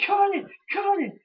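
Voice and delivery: high mocking wrestler voice; whiny Punk impression. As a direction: squeak it like a spoiled sore loser. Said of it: "He's like".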